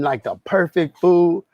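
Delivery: ordinary talk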